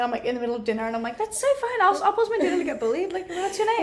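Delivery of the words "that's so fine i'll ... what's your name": mock cheerful. Mock cheerful reply to bullies.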